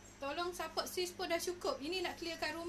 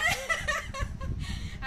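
high laugh; 10 semitones higher, 5 dB louder than usual. Real, not acted. Laughs between sales.

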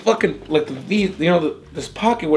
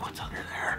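secret, curious whisper; 17 dB quieter than usual. Retold or imitated. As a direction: breathe it like a naughty secret. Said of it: "you're like".

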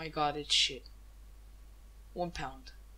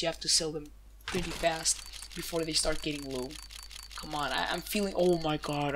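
ordinary talk

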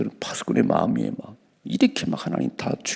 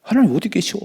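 much higher, sneering scoff; mocking sinners; 13 semitones higher than usual. Preacher mimicking the wicked who scoff that God doesn't see, very high. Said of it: "오늘 여기 보시잖아요".

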